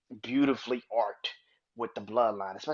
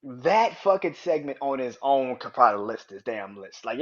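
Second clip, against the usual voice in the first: ordinary delivery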